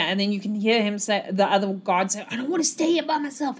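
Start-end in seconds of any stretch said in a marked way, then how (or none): 2.3-3.6 high, quick, hushed whine; frightened pleading. Acted. Nervous guard begs partner not to leave, whining. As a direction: plead like a guard scared to be left.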